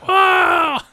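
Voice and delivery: high, loud shocked scream; horrified scream. Retold or imitated. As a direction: scream in horror at the sight. Reacting to the dangling fingertip.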